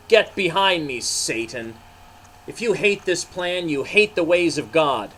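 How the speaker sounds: stern rebuke. Acted. Jesus rebuking Peter, stern and fiery. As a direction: rebuke him firmly. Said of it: "His eyes made sparks of fire".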